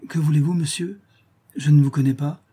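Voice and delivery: strained politeness to a stranger; concealed alarm. A narrator's reading. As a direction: try to hide the fear in your voice. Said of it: "en tâchant de dissimuler l'altération de sa voix".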